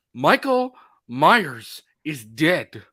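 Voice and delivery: slow, drawn-out melodramatic line; overacting. Hammy actor overplaying his line about Michael Myers. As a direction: ham it up like a bad actor. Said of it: "Bro, you're overacting".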